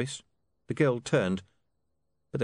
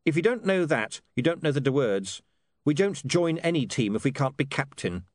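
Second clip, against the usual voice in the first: lightly voiced William; 6 semitones higher than usual. A narrator's reading. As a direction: give the son an animated, raised pitch.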